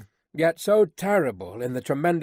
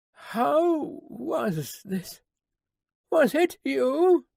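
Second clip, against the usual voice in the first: put-on higher, slow, wondering old man's question; a dazed father reaching for a memory; startled old prisoner; 9 semitones higher, much slower than usual. A narrator's reading. Dazed old father asking his daughter, slow and higher.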